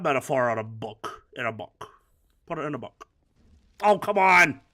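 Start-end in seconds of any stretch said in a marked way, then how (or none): 3.8-4.6 loud, higher protest; real exasperated shout; exasperated outburst. Real, not acted. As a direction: cry out in frustration at the game. Calls out at the game.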